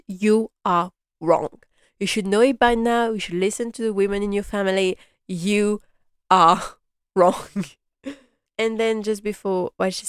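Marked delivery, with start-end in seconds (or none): 0.1-1.6 YOU ARE WRONG, each word drawn out slowly; mock telling-off, spacing out YOU ARE WRONG; emphatic scolding. Fan scolds Robert over his move, emphatic YOU ARE WRONG, slowly. 5.3-7.6 YOU, ARE, WRONG with a pause between words; slow mock telling-off, WRONG hit loud; emphatic scolding. Fan scolds Robert for not listening, YOU ARE WRONG word by word.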